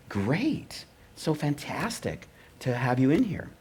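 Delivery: high-pitched polite gushing; mock gushing. Executives gush after the pitch.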